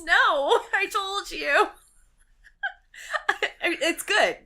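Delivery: laughing through words; giggly, high and loud. Real, not acted.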